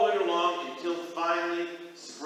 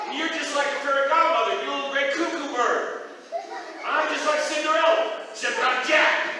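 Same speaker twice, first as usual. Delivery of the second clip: excited Jack; 11 semitones higher, 7 dB louder than usual. Acted.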